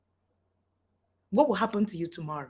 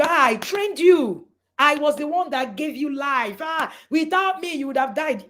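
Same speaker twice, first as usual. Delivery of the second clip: higher-pitched, emphatic parent scolding; guilt-trip your child, voice raised; indignant parent; 5 semitones higher, 4 dB louder than usual. Acted. Parent guilt-tripping the child, acted.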